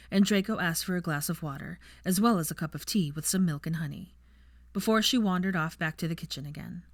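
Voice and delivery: ordinary delivery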